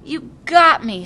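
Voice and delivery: put-on a grinning child admits defeat; called out. A narrator's reading. Shouting you got me across the field. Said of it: "she called".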